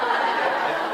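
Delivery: unvoiced burst of real laughter; loud real laugh. Real, not acted. To the audience about blue eyes, a real burst of laughter.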